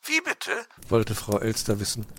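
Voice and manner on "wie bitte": very high bird voice asking; puzzled magpie. Acted. Magpie asks over phone, very high puzzled chirp. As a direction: chirp a confused question.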